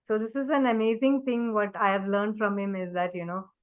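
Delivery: usual speaking voice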